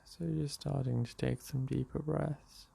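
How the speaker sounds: unhurried meditation voice with long pauses; guided meditation. Real, not acted. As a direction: guide them gently into the breath, leaving space between phrases. Leads a morning meditation.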